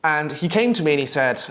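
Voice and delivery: ordinary delivery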